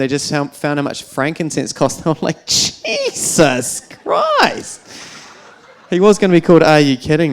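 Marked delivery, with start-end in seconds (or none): 2.5-4.8 very high, very slow drawn-out exclamation; exasperated Jesus Christ. Pretend. Reacting to the price of frankincense, a high, slow gasp. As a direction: stretch it out in mock disbelief.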